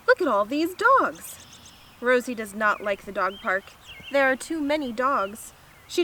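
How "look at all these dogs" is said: higher, eager exclamation; enthusiastic. Acted. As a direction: marvel brightly at the sight. George enthuses at the dog park.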